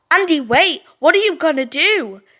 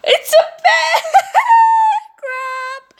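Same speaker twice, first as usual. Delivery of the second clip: shocked scream; 5 dB louder, slower than usual. Real, not acted. Bear appears, real shock.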